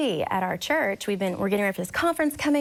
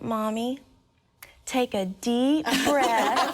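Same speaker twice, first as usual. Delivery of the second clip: put-on slow little-girl voice coaching mom; imitated toddler; much slower than usual. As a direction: instruct mom like a solemn small child. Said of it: "she looked at me and she said".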